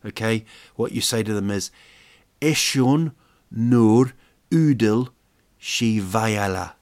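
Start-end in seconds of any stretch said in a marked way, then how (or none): 2.4-6.7 Eshun nur udel shivayala drawn out slowly; slow Armenian phrase. Shown as a demonstration. Teaching an Armenian insult.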